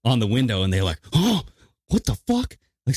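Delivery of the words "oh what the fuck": high startled yelp; mock shocked neighbor. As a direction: jump out of your skin at the window.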